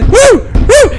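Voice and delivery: high, loud imitated monkey whooping; piercing mimicked ape calls; baboon whoops.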